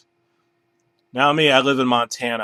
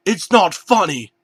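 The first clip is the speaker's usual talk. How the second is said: high, louder put-on bear voice; upset bear voice; 9 semitones higher, 7 dB louder than usual. Bear protesting at laughing bananas, high and whiny. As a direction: whine it like a hurt bear. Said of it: "the bear said".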